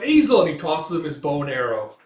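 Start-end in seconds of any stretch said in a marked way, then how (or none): 0.0-0.4 high, loud shout; urgent name call. Retold or imitated. Rider tosses Azel his bow, urgent shout. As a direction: call to get attention.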